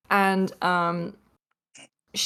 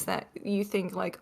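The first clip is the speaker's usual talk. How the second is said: ordinary delivery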